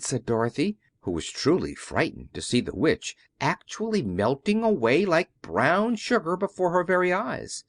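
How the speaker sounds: usual speaking voice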